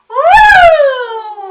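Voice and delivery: loud, high siren wail; siren woo. Shown as a demonstration. Teacher showing a child a loud siren wail. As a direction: howl it out like an ambulance siren. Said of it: "Louder, louder".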